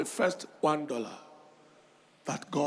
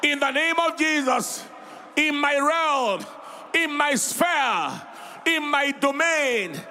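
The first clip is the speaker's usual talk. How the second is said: preaching at full force; 6 semitones higher than usual. Real, not acted. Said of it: "Say it louder".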